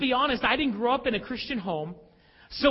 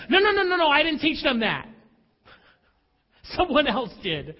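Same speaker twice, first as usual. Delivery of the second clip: loud mock denial, trailing into a laugh; a parent loudly denying the blame; protesting shout; 4 semitones higher, 5 dB louder than usual. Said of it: "We think this".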